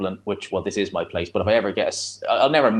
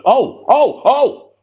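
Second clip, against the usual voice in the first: sudden high, loud cries of excitement; excited shout; 9 dB louder, much slower than usual. Real, not acted. Reacting to the boxer coming back, excited yelp. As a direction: yelp as the fighter storms back.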